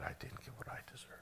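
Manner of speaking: preacher confessing to the congregation in a whisper. Real, not acted. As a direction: hush it like a private confession. Humble confession after plea.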